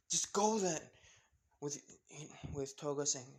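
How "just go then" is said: raised, higher acted urging; urging her away. A narrator's reading. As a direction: push her to leave. Trapped boy urges her away.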